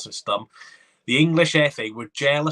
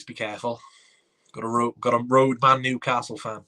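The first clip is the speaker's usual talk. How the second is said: ordinary delivery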